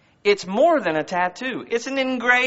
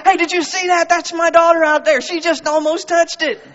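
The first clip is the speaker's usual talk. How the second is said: high, loud sideline bragging; excited proud dad; 9 semitones higher, 5 dB louder than usual. Retold or imitated. Father bragging about his daughter's near touch, excited shouting. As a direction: crow to everyone within earshot.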